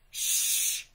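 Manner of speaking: long, forceful "shh"; loud shushing. A narrator's reading. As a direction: hush a noisy reader sharply. Library patron hushes roaring Bear.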